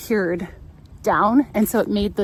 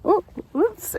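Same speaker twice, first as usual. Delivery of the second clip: light sympathetic oops; 11 semitones higher than usual. Retold or imitated. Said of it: "I just expected at least".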